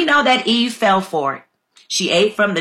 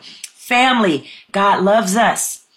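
slow, deliberate, calmed after excitement; catching himself and slowing right down; slower than usual. Real, not acted. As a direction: rein it in and speak slowly. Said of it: "I gotta slow down".

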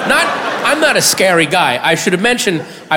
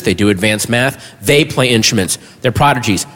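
normal talking voice

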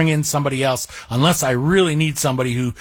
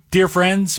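usual speaking voice

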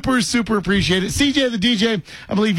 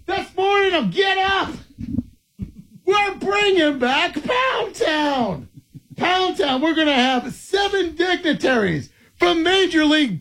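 hype announcer; 8 semitones higher, slower than usual. Acted. Hyping the Pound Town segment.

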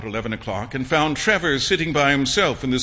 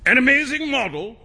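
high mock-indignant shout; shouted question; 10 semitones higher than usual. A narrator's reading. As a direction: fling it back, outraged by the doubt. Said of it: "shouted Trevor at the top of his voice".

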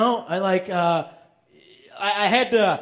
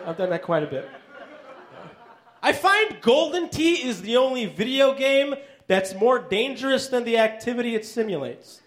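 ordinary talk, nothing marked

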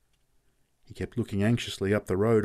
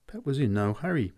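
plain reading voice

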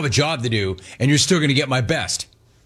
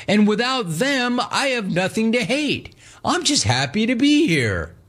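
lightly mocked contented caller, pitched higher; Gino; 9 semitones higher than usual. Caller tells host he has made peace, mock-contented, higher. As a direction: imitate a caller with nothing left to hate. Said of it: "I did not expect Gino to say".

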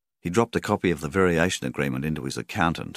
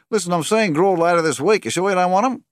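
very high, loud, insistent agent; agent Colin; 9 dB louder than usual. A narrator's reading. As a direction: push hard for the sale, rising on the question. Agent Colin pressing his client loudly.

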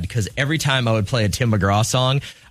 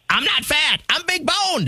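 very high, whiny cartoon boy voice; Cartman impression. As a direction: squeal it like a spoiled kid. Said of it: "Which South Park character said".

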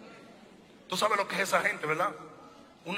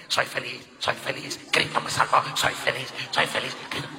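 half-whispered mock affirmations; mock self-help chant; mock declarations; 4 semitones lower, 5 dB louder than usual. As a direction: parody someone reciting positive declarations. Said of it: "haciendo declaraciones".

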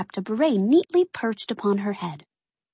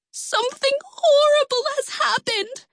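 high tearful cry, much higher. Acted. Twins bursting in upset to the spies, high tearful cry. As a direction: wail it out like the twins in tears. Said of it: "they cried".

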